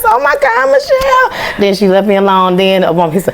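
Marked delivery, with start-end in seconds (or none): 0.1-1.6 starstruck squeal. Retold or imitated. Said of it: "reliving meeting".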